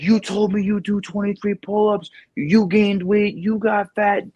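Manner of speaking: angry father. Retold or imitated. Dad scolding his son for lying about pull-ups: high mock-angry rant. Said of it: "starts flipping out".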